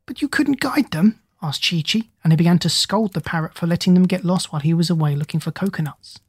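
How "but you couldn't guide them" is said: monkey's question. A narrator's reading. Monkey questions parrot in story.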